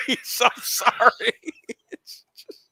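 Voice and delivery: high, slow words squeezed through laughing; helpless laughter. Real, not acted. Viewer cracking up over someone so sorry, helpless laughing. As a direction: lose it and barely get the words out.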